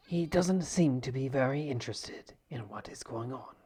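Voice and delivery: hushed nature documentary narration. Acted. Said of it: "National Geographic".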